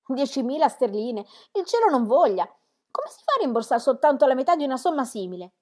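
high and loud in dismay; shocked outcry. A narrator's reading. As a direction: cry out at the huge sum. Daughter shocked by the sum.

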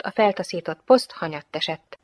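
ordinary delivery, nothing marked

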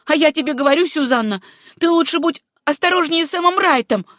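loud, high warning; a jealous shout of warning; angry cry. A narrator's reading. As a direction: fling the warning at her. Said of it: "крикнула Руфь".

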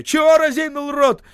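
high, loud order; urgent shout. A narrator's reading. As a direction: yell it as a warning. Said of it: "крикнул Осип".

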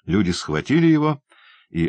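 usual speaking voice

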